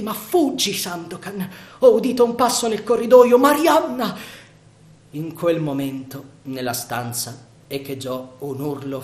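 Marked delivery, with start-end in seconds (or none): 0.0-4.2 high, loud and fast, in alarm; urgent alarm. Acted. Marianna urges Sandokan to flee, frantic high warning. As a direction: hurry him away before they're caught.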